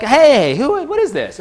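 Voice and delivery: sharp high mock outrage; indignant surprise. Lecturer acting a bystander finding vandalism, mock indignant surprise. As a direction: catch someone trashing your place, half playful.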